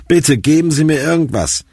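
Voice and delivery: a touch of desperate begging; pleading. A narrator's reading. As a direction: plead for anything to stop the pain. Patient begs for painkillers.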